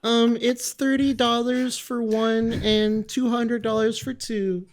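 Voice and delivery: very high, slow put-on voice; mock sales pitch. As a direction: sell commissions in a silly voice.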